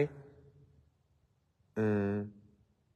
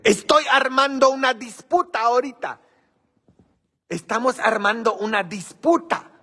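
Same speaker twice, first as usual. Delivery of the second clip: much higher and louder, mock-mischievous; playful high teasing; 12 semitones higher, 6 dB louder than usual. Real, not acted. Host teasing viewers about starting a fight, high and loud. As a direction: sing it out like you're stirring trouble on purpose.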